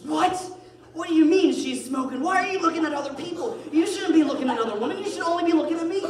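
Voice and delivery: high, loud mock outrage; Holly. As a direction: yell like an indignant, jealous wife. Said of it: "Holly goes".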